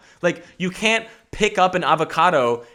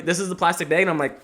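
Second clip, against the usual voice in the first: ordinary talk, nothing marked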